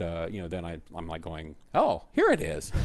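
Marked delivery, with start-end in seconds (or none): 1.7-2.7 high-pitched mock surprise; playfully acted pleasant surprise; retold delighted discovery. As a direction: voice jumps up as you act out finding it. Retelling his find on YouTube.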